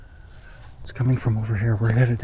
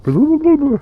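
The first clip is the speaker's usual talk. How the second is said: rising gibberish that jumps much higher and louder; mock Bigfoot chatter; 7 dB louder than usual. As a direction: babble it like a made-up creature language. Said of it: "the language that Bigfoot speak".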